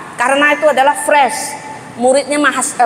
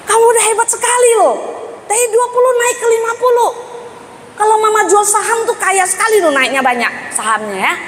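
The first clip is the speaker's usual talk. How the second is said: high, quick, delighted praise; excited praise; 9 semitones higher than usual. Retold or imitated. Mother cheers son's jump from twenty to fifty, high and quick. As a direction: cheer him on like great news.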